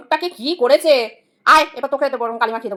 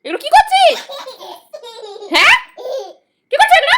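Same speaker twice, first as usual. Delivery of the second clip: laughing disbelief; 11 semitones higher, much faster than usual. Real, not acted. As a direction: laugh out the question at the mess. Laughing at child's mess.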